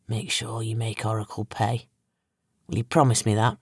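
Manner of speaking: low, quiet bitterness, a touch understated; bitter. A narrator's reading. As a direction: press the demand with muted resentment. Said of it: "he asked bitterly".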